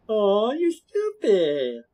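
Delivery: drawn-out, high-pitched mock sympathy; slow, teasing, exaggerated sarcasm; sarcastic. Shown as a demonstration. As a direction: stretch it way out with a sarcastic lilt. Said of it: "But one's sarcastic".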